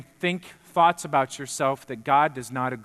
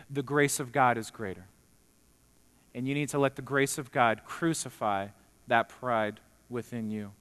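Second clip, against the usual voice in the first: normal talking voice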